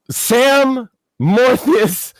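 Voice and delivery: ordinary talk, nothing marked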